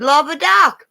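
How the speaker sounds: squeaky mock exclamation; comic old exclamation. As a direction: blurt it like an old granny. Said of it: "Good Lord".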